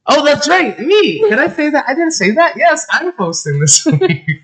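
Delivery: excited realization. Real, not acted. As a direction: exclaim it high, laughing into the end. Remembers she is the host herself.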